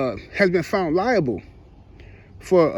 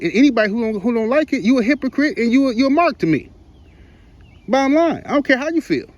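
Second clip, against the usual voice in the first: heated; 6 semitones higher than usual. Real, not acted. Heated rant at hypocritical viewers.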